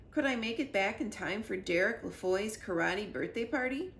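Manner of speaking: ordinary delivery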